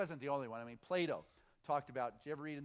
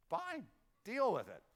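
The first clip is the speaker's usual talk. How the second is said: dismissive; 5 semitones higher than usual. Acted. Mock dismissive reply.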